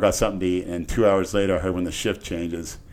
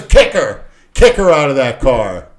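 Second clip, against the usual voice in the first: loud, higher barked order; loud cop order; 4 semitones higher, 9 dB louder than usual. Retold or imitated. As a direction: order him like a rough cop.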